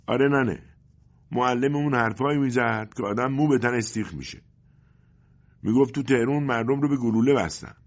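put-on boy telling news. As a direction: tell mother eagerly like a child. Boy tells mother news.

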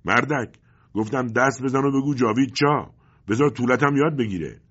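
loud, high angry yelling; furious shout. A narrator's reading. Agent yells at silent father to obey, furious shout. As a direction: repeat the order, yelling with contempt. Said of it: "فریاد زد".

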